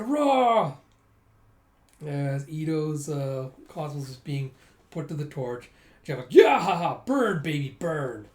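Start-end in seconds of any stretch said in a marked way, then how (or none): 0.0-0.8 a sudden, much higher, loud monster cry; monster roar. Acted. Monster bursting onto the scene, roaring loudly. Monster roars at the burning clinic. 6.3-8.3 loud, cackling glee at the flames; gleeful pyromaniac. Acted. Jeff gloating as the house burns, gleeful and loud.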